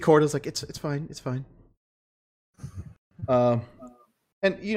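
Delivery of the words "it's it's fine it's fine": quiet reassurance. Acted.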